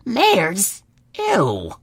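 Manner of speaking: put-on high, boyish, grossed out; a kid saying yuck; disgusted colt. Young son reacting to talk of mares, high and grossed out.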